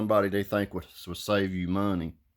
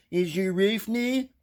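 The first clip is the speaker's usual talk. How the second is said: mock agent question; 10 semitones higher than usual. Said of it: "They said".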